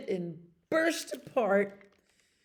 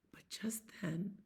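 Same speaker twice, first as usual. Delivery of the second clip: whisper; 12 dB quieter than usual. A narrator's reading. Suspense in bedtime story.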